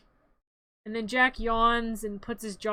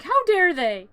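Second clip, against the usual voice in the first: higher, louder indignant outburst; mock mad; 6 semitones higher, 7 dB louder than usual. Retelling Jack's anger after the failed Christmas, mock indignant. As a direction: imitate an outraged protest. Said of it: "He's so mad".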